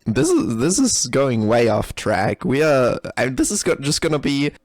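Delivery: ordinary talk, nothing marked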